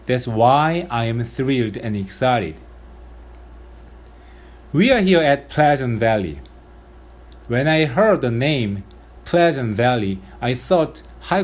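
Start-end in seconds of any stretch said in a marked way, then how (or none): none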